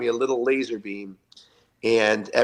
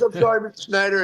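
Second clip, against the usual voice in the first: sheepish apology; 9 semitones higher, 4 dB louder than usual. Retold or imitated. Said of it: "He goes".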